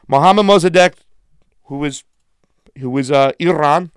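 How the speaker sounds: loud sharp voice; yelling. Real, not acted. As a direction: shout out each name and country. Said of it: "sorry for yelling so loud".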